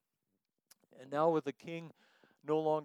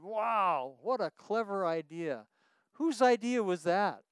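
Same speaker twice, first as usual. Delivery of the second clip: impressed farmer calling up; 5 semitones higher, 6 dB louder, slower than usual. Acted. Farmer voiced in duck story.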